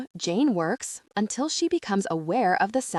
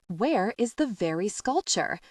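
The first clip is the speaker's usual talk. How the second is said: ordinary delivery, nothing marked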